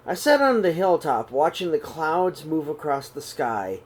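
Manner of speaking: usual speaking voice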